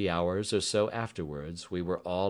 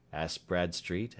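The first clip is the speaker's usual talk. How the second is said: ordinary delivery, nothing marked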